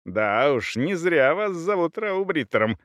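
lightly needling, pitch lifting on the nickname; unheeding taunt. A narrator's reading. Captive ignores the cavalier and taunts him, unheeding. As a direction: carry on as if unanswered. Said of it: "словно не слышал его".

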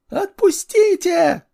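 shrill, very high, slow woman's shriek; hysterical woman. Acted. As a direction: scream it in panic like a woman. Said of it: "истерила какая-то баба".

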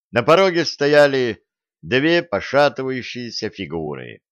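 plain reading voice

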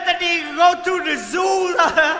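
laughing. Retold or imitated. Mocking own bad singing.

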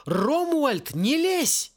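high-pitched mock yell, no real volume. A narrator's reading. Boy yelling at house-elves to stay out, high yell. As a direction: yell a warning, voice pitched right up. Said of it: "набрал воздуха и заорал".

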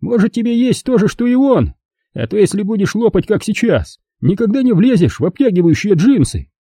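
high, teasing jab; mocking jab. Kurt teases Lauren about her weight, high and mocking. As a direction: needle her playfully.

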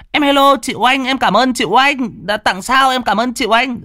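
high, loud, sweet thanks; squealing thank-you to a generous sister; gushing thanks. Real, not acted. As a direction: beam out thanks to the donor. Thanking a viewer for gifts.

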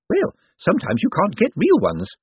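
a fussing carer covering her fear; nervous nurse. A narrator's reading. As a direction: Miss Drapes fussing over her patient, high and jittery.

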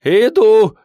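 very high, loud yell; frantic high falsetto call; cracking falsetto shout. A narrator's reading. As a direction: shriek it up in falsetto. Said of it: "срывающимся фальцетом кричит".

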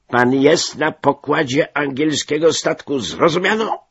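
high, loud bullying shout; captain's shout. A narrator's reading. Captain trying to intimidate the narrator, loud shout. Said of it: "krzyknął bez sensu kapitan".

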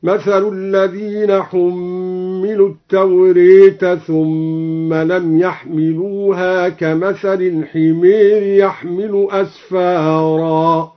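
"التوراة" leaned toward tawreh, slow chant; Quranic recitation with imala. Shown as a demonstration. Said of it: "أمال التوراة". (ترتيل بإمالة "التوراة")

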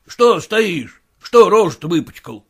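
put-on loud, sharp scolding of a servant boy. Master yelling at his errand boy, angry and loud. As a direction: bark at the boy for his dirty face.

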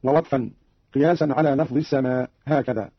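normal talking voice

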